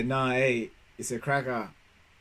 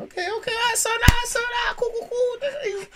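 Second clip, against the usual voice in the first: squeaky mock praise; 3 dB louder than usual.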